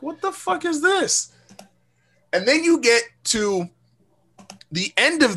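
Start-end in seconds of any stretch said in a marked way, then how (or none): 0.0-1.3 high, slow "What the fuck is this?" in disbelief; drawn-out disbelief. Retold or imitated. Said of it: "I was like".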